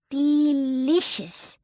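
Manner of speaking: put-on high, slow, contented baby sigh; happy sigh. A narrator's reading. Baby Barbara, delighted, basking in the sun. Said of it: "with a happy sigh".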